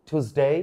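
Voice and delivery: "টিউসডে" as "t-hoos-day"; aspirated T, plain "oo" in "টিউসডে"; correct variant. Shown as a demonstration. Teacher recapping variants, "টিউসডে" pronounced "thoos-day". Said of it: "এটাও সঠিক".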